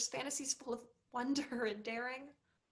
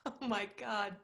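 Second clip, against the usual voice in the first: usual speaking voice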